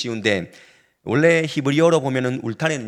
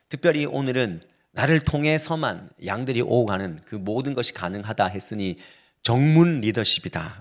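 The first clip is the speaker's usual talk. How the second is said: ordinary delivery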